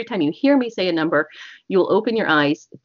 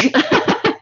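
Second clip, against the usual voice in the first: high laugh; 12 semitones higher, 4 dB louder than usual. Real, not acted.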